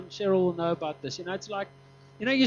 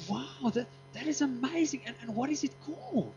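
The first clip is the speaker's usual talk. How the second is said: imitation British accent, higher and softer; 4 semitones higher, 5 dB quieter than usual. Woman on the plane asks what it is called, mock British accent. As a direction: put on an impressed British accent. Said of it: "in the beautiful British accent".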